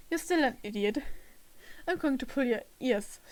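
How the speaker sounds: ordinary talk, nothing marked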